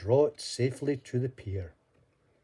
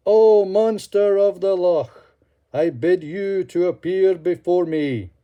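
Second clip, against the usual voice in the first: a louder solemn summons; louder call; 7 semitones higher, 10 dB louder than usual. Acted. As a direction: call out harder to the loch. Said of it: "cried out in a louder voice".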